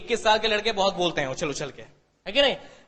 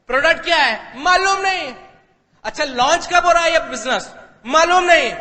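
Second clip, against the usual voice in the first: loud, raised mimicked question and answer; exasperated quoted exchange; 5 semitones higher, 6 dB louder than usual. Retelling being quizzed about the company, loud and exasperated. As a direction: act out a heated, clueless back-and-forth.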